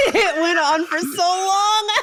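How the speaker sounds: laughing through the words; cracking up, can barely get it out; slow, laughing and near tears. Real, not acted. Laughing hard at clip.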